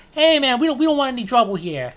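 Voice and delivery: high, loud, wary barkeep voice; nervous bartender. Acted. Bartender to armed stranger, high and anxious. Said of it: "the bartender goes".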